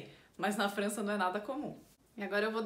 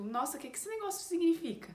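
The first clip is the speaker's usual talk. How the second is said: puzzled learner; 6 semitones higher, faster than usual. Pretend. Learner puzzled by French word.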